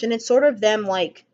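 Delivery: ordinary talk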